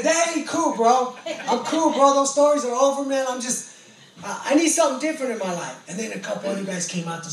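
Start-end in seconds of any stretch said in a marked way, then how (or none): 0.0-5.8 loud, high pleading; earnest insistence. Retold or imitated. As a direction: tell the guys it's over. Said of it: "I just said".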